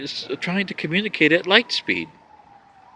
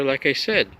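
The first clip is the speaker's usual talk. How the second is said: usual speaking voice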